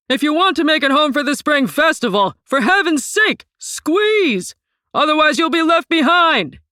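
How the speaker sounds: high, loud hollered warning; hollering conductor. A narrator's reading. Conductor hollering at the crowd to squeeze aboard. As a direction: holler over the hissing train. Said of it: "and the conductor hollered".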